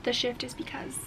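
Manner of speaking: breathy whisper of an explanation. Real, not acted. Viewer asks about the name, answered in a whisper. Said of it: "unvoiced whisper".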